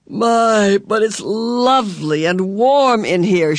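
high, slow, creaky delight; creaky, quirky visitor. A narrator's reading. Missus Whatsit admires the warm kitchen, creakily delighted. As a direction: draw it out with high swoops. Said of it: "A voice like an unoiled gate".

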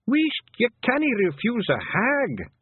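very high, slow little clansman; scolding Feegle. A narrator's reading. Feegle safe at the back scolds Twiddler, high voice. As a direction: hush him in a high, drawn-out voice.

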